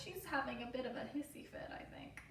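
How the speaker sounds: fast, soft whisper; whispered aside. Real, not acted. Narrator whispering an aside about the upset child.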